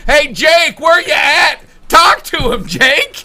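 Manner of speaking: calling out loudly, high; shouting. Acted. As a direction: shout across the room. Calling out to Jake.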